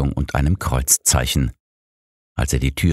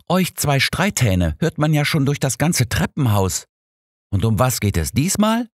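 slightly annoyed; 11 semitones higher than usual. A narrator's reading. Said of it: "fragte sie leicht genervt".

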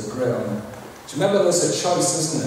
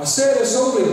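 ordinary talk

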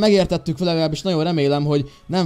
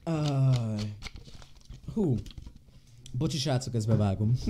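quiet aside; 2 semitones lower, 9 dB quieter than usual. Real, not acted. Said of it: "közben kicsikét iszok".

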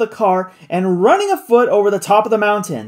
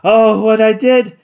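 laughing; 4 semitones higher, 6 dB louder, slower than usual. A narrator's reading. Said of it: "laughed the other".

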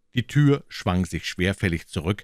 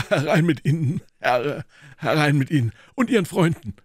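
high, loud hearty welcome; waving them in with a shout; loud, high boxer's call; 9 semitones higher, 5 dB louder than usual. Acted. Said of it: "rief er".

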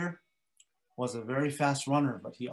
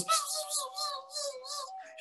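very high fading buzz; mosquito buzz; 8 dB quieter, slower than usual. Acted. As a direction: buzz round inside the head. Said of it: "He goes".

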